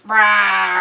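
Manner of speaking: loud pretend bear growl; mock bear roar. Joking that the wrestlers are bears, mock roar.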